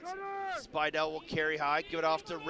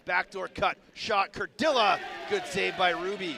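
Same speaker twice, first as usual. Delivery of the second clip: raised, louder play-by-play burst; excited shot call; 5 dB louder than usual. Real, not acted. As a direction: spike on the shot, stay up for the save. Calling a shot and save live.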